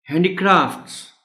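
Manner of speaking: Handicrafts with a high, long crafts; stress on R. Shown as a demonstration. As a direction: punch the late Kra in handicrafts. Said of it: "एक तो ये stress".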